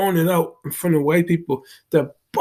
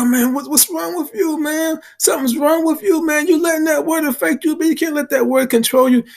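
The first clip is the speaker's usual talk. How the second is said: higher lecturing voice of a pushy stranger; imitating the stranger; 11 semitones higher than usual. Big guy lecturing him about the word, in a high mock voice. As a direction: preach at him like you know better, pitched up. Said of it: "He's like".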